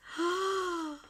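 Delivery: high, breath-catching gasp; amazed gasp. Real, not acted. Marveling at a friend's gift, delighted gasp.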